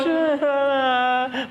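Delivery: a loud, high burst of laughter; hearty laugh. Real, not acted. Preacher laughing at weeping newlyweds: loud high laugh. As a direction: laugh out at the picture of the crying bride.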